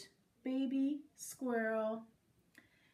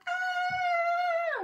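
very high loud scream; sudden piercing shriek; yell; 10 dB louder than usual. A narrator's reading. Teacher spotting a mouse, terrified high scream. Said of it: "the teacher yelled".

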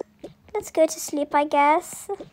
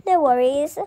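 normal talking voice

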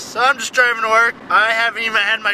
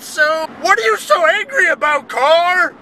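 mock exasperated question; 7 semitones higher than usual. Vlogger scolding the honking car, incredulous and raised. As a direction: throw it at the car in disbelief. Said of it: "that's what I meant".

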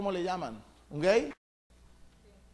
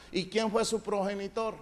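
normal talking voice

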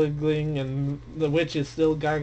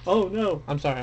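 ordinary talk, nothing marked

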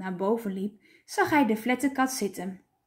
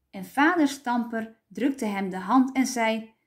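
ordinary delivery

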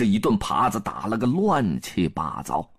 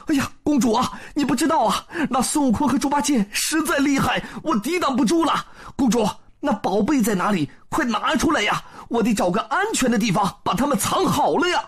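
fake flustered husband; 11 semitones higher, faster than usual. A narrator's reading. Disguised Wukong fooling princess.

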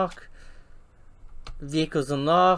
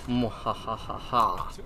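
slow, low villain cackle; evil laugh; 5 semitones lower, 6 dB quieter, much slower than usual. Pretend. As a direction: cackle like a mad scientist.

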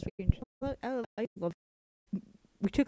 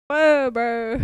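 mock surprise; 3 semitones higher, 10 dB louder than usual. Real, not acted. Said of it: "reacting to the text".

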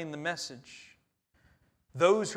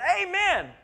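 joyful amen; 13 semitones higher, 7 dB louder than usual. Retold or imitated. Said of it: "and we all went".

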